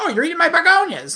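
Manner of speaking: high-pitched mock scolding; mock annoyance. Host mimicking the movie mom over her begonias, mock annoyance. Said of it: "very quick to get mad".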